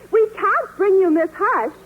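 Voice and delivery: ordinary talk, nothing marked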